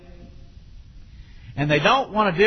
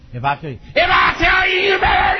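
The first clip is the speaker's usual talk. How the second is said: high, loud, escalating mock yell; hollering parent; 9 semitones higher, 6 dB louder than usual. Shown as a demonstration. Parent threatening the children, hollering. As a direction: holler it like a parent losing control. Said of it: "Don't holler at your children".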